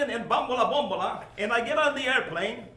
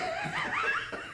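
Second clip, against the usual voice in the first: high giggle; 6 dB quieter than usual. Real, not acted. Laughing at his own story.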